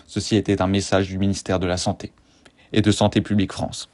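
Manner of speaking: low, fast government ad read; public service announcement. Acted. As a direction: read it like a health ministry ad. Public health ad parody.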